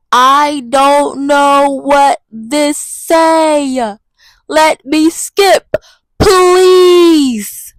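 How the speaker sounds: very slow, drawn-out whine; slow, helpless whining; whiny stalling. Acted. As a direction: stretch every word out like a lost kid. Pleading with the Spanish program.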